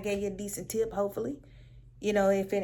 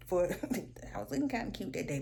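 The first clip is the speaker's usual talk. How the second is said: ordinary delivery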